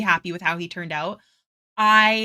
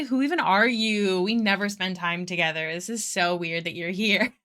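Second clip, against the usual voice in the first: normal talking voice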